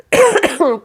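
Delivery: short dry cough; a real throat-clearing cough. Real, not acted. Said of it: "Pardon, je tousse".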